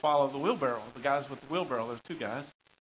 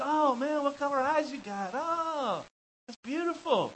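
high-pitched cooing admiration; gushing admirer; 9 semitones higher, slower than usual. Acted. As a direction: swoon over it in a put-on high voice. Acts someone admiring eyes.

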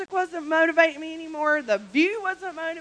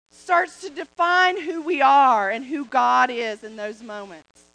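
normal talking voice

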